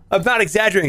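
ordinary talk, nothing marked

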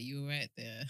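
put-on low, soft Nigerian-accented voice. Quoting the singer who caught her eye, Nigerian accent. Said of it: "in the Nigerian accent".

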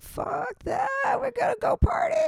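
high careless party voice; parents mocking. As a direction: sneer it like carefree parents. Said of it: "My parents are like".

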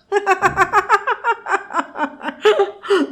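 high, carefree laugh; happy laugh. A narrator's reading. Friend laughs off the narrator's vow, carefree laugh. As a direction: laugh it off easily. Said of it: "హాయిగా నవ్వేసి".

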